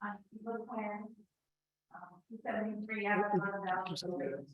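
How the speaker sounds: far from the microphone; quiet, distant, away from the mic. Real, not acted. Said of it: "so please come to the podium".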